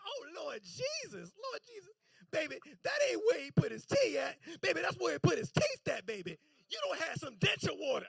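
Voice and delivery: high alarmed shouting Lord Jesus; a horrified mother crying out to her son; alarmed mom shout. Retold or imitated.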